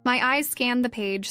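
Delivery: ordinary delivery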